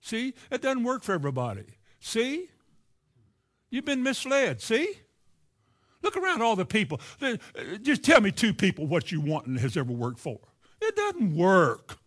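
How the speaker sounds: needling devil, high lilting "See?" jabs. Acted. Devil telling a believer it won't work, in a needling voice. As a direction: plant doubt, pitch lifting on each "see", slowing on the last line. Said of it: "That's what the devil says".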